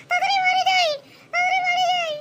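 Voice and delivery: frightened wife pleading with her lover; panicky wife voice. Acted. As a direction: play the caught-out wife, high and fretting. Male performer voices wife.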